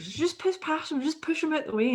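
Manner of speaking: high, pushy mock girl voice; squeaky bossy girl telling her friends to shove past; a lightly voiced bratty girl; mocking English girl. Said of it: "she was English".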